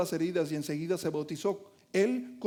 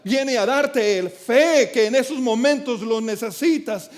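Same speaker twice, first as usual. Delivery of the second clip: rising preacher's fervor, louder; fervent preaching; 2 semitones higher, 6 dB louder than usual. Acted. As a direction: preach it with full conviction. Preaching build. (predicación fervorosa, más fuerte)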